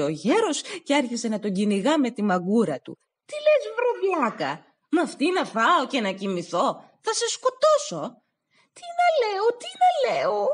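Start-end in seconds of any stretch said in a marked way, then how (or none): 3.2-8.2 put-on old man chasing him, furious. 8.7-10.5 much higher, pleading cry; desperate plea. Acted. Stavros pleads again.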